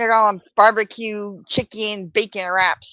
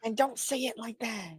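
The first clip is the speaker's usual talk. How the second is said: much softer, muted objection; subdued scolding; 14 dB quieter than usual. Real, not acted. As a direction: repeat the complaint quietly. Repeats the objection under their breath.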